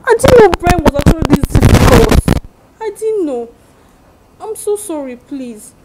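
loud, desperate begging; pleading apology. Acted. Friend loudly begging forgiveness after bad advice.

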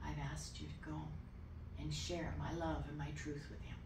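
hushed, low-volume divine prompting; quiet, unhurried inner voice; God's gentle voice. Retold or imitated. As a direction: speak very softly, like an inner voice. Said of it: "the next voice that came was".

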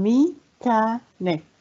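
"Mechanic" very slowly as me, cha, nic; syllable by syllable. Shown as a demonstration. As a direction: leave gaps between the three parts of "Mechanic". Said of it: "كم عدد المقاطع".

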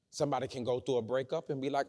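ordinary talk, nothing marked

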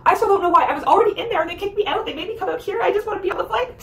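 high-pitched overwhelmed complaint; distraught pleading. Retold or imitated. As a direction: plead in a high voice, on the edge of losing it. Retold near-tears plea to airline agent.

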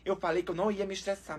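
low, soft, resigned complaint; deflated calm. Real, not acted. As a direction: say it under control after an outburst. Calms down after the shouting.

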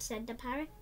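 usual speaking voice

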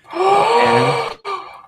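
sharp high gasp of wonder; delighted gasp. Acted. Narrator voicing the gnome seeing the magic wand, gasping in awe.

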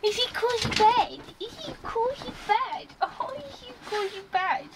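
high cooing voice to a pet. Real, not acted. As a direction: coo at the dog, very high. Baby talk to the dog.

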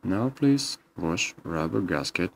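normal talking voice

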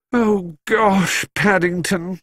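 put-on high, groaned girlish dismay; groaning dismay. A narrator's reading. Judy realising what Paddington has done, groaning. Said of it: "groaned Judy".

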